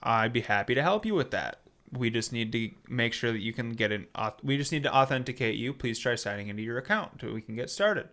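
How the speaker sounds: low, soft, even chatbot read; quiet, unruffled customer-service patter; totally calm. Retold or imitated. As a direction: recite it like an unbothered support bot. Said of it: "totally calm".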